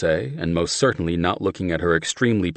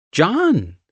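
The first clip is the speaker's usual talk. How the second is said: put-on Southern accent; 13 semitones higher, 6 dB louder than usual. A narrator's reading. Steph's accent voice.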